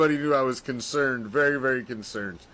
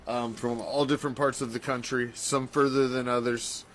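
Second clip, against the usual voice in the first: usual speaking voice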